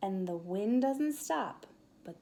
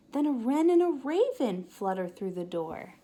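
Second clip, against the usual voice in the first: usual speaking voice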